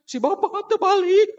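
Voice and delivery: loud, high comic wail; high, loud put-on sob; mock crying. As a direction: play a wife wailing, hammed up. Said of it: "Ibu keur nangis".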